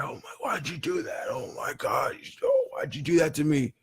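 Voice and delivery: breathless, half-whispered panic after being dunked; gasping panic. Retold or imitated. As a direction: gasp it out like you just came up from drowning. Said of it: "he's gasping for air".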